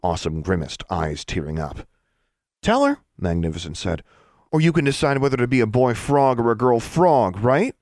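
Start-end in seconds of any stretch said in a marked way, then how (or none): 2.6-3.0 high, loud command; forceful order. Acted. Sibling forces confession. 4.5-7.8 loud, raised-pitch threat; threatening sibling. Acted. Older brother threatens younger sibling.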